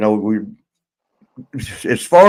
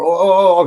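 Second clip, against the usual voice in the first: high, louder groans of pain; pained moaning; 5 semitones higher, 3 dB louder than usual. Retold or imitated. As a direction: moan like someone who just fell down stairs. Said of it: "sitting there going".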